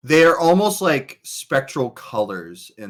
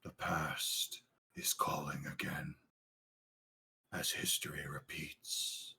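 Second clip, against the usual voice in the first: low, hushed, mysterious narration; ominous whisper; 7 semitones lower, 19 dB quieter than usual. Acted. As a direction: whisper it like a prophecy from the void.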